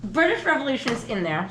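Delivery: usual speaking voice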